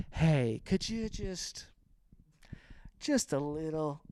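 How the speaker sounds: soft, hesitant, trailing request; timid mock request. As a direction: awkwardly ask strangers to tone it down. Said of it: "being like".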